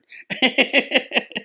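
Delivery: a high breathy laugh; burst of laughter. Real, not acted. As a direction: let a real laugh burst out after the punchline. Laugh after a punchline quote.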